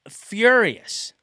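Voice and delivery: the word punched high and loud; furious. Retold or imitated. Recalling the trashed new car, loud furious word. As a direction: spit out how mad you were. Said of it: "And I am just".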